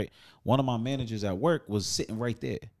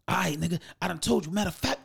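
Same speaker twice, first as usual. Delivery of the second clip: heated customer; 8 semitones higher than usual. Retold or imitated. Customer voiced, rising.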